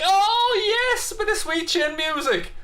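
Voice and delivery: very high, held-out cry of delight; high-pitched fan's relish; gleeful excitement. Real, not acted. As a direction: whoop it out high like a thrilled fan. Relishing a favourite wrestling finisher.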